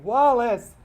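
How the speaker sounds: high, loud, drawn-out pleading shout; desperate yelling. A narrator's reading. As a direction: yell like a mother begging her husband to stop. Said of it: "would be dragged along the driveway yelling".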